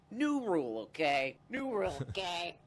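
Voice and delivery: a light take on Bill Maher's announcer punch; Bill Maher impression. Host announcing new rules in a lightly done Bill Maher voice. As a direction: hit each new rule like a late-night host. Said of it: "doing the Bill Maher bit".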